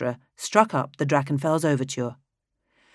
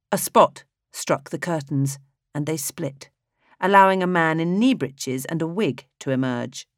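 ordinary delivery, nothing marked

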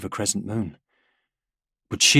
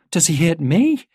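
put-on high child voice, louder, rising; child's anxious question; 9 semitones higher, 7 dB louder than usual. A narrator's reading. Daughter asks her father about the Despoiler, high anxious voice. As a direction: ask it as a frightened little girl.

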